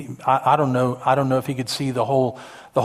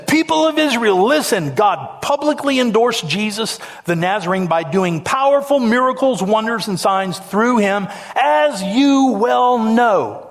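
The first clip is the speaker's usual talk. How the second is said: high, ringing address to a crowd; public proclamation; 5 semitones higher than usual. Acted. Peter steps up before the Pentecost crowd, boldly proclaiming.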